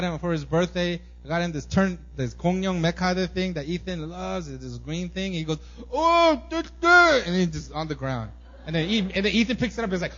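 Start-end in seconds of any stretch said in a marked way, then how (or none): 5.9-7.2 put-on high, loud little-kid noises; imitated child outburst. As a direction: mimic a kid's loud, high babble. Acting out child receiving toy.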